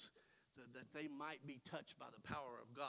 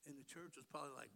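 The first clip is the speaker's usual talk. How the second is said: usual speaking voice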